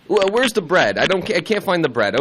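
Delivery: high, loud, flustered shopper; John. Acted. Impression of co-host at a grocery store, flustered. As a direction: hurry it out in a high, helpless fuss. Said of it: "this is John at a grocery store".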